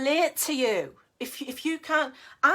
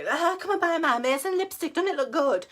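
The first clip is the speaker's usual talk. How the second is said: a higher, hammed-up sales voice; mock salesy pitch; 6 semitones higher than usual. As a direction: gush over the lipstick like a cheesy seller. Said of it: "I'm not gonna do that".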